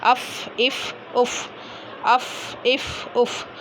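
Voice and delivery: short vowel, then a held airy f in अफ, इफ, उफ; letter fa demonstrated. Shown as a demonstration. Teacher showing students where fa comes from, careful articulation. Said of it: "ये होंठों के अंदर वाले हिस्से से".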